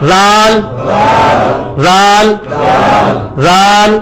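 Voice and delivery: "زال" with the tongue on the teeth, a voiced th and no hiss; Arabic dhal. Shown as a demonstration. Teacher drills the Arabic dhal sound, tongue on the teeth. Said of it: "زال بھی پڑھنا ہے، اسی جگہ پہ".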